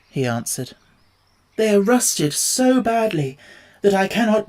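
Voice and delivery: lightly voiced rusty Tin Woodman; stiff rusted tin man. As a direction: speak like joints that barely move. Rusted Tin Man asks for oil.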